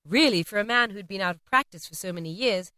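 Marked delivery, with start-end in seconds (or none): none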